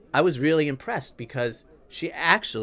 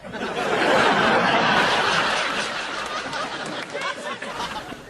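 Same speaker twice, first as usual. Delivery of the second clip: high laugh. Real, not acted. Laughs at own punchline.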